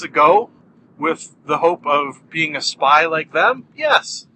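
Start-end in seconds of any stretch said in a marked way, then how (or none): 3.8-4.3 sudden high, bright answer; triumphant yes. Acted. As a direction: announce it like a game-show reveal. Triumphant answer to own question.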